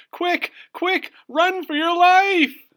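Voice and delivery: very high, very loud shouted warning; cartoon fox shouting. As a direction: yell a panic alarm. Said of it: "shouted Foxy Loxy".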